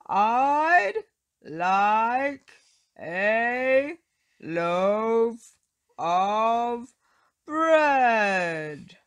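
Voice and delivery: loud, word-by-word roar; furious roar for bread. Acted. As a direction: roar each word separately. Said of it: "roared Mister Noisy".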